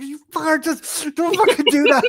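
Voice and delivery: loud, high retold protest at a friend's prank; retold outrage. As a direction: playfully yell at a friend's prank. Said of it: "And I'm like".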